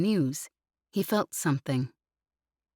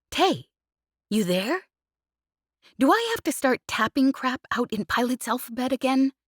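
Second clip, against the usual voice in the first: higher, teasing pilot voice, pestering her friend; cheeky pilot Jez; 9 semitones higher, 5 dB louder than usual. Acted. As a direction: needle him playfully, pitched up.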